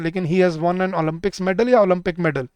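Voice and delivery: normal talking voice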